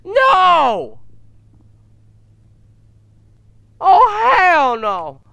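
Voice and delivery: high, loud refusal; real panicked yell; panicked shout. Real, not acted. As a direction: yell it out in fright. Frightened outburst at game.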